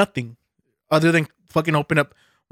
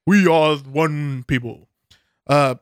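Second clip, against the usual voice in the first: mock presidential; slower than usual. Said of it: "he doesn't talk like this".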